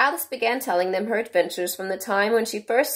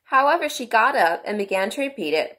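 usual speaking voice

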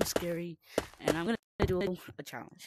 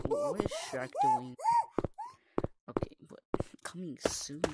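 ordinary delivery

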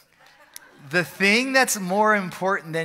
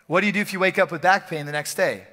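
normal talking voice

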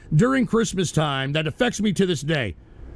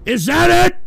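high, loud yelled challenge; furious shout; 10 semitones higher, 8 dB louder than usual. Real, not acted. Streamer screaming at trolls mocking him, furious. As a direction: scream it at the chat.

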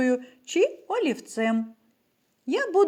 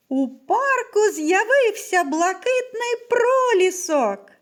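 high, raised exclamation with extra feeling; exclamatory; 9 semitones higher, 4 dB louder than usual. Shown as a demonstration. Teacher demonstrating an exclamatory sentence, high emphatic exclamation. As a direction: proclaim it loudly, pitch lifted all through. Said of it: "вимовляється із підвищеною інтонацією".

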